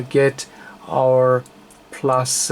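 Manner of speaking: normal talking voice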